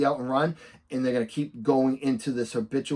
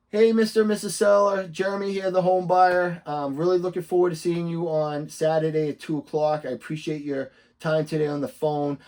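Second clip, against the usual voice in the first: upbeat video greeting; 3 dB louder than usual. Shown as a demonstration. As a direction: open big, as if recording a message for a client. Demonstrates a video text greeting.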